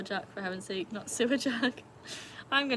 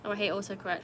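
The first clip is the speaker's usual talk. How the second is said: ordinary delivery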